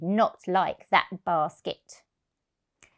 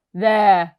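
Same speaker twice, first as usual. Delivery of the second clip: vet's voice; 9 dB louder than usual. Acted. Vet voiced.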